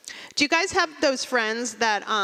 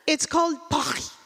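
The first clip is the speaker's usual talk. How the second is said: higher, snobby fake French Paris; fake French accent; 4 semitones higher than usual. Friend back from Paris shows off fake French accent. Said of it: "She goes".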